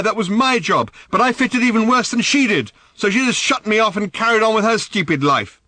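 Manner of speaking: high, loud furious challenge; angry demand. Acted. Daughter demanding angrily of her father. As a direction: spit the question at him.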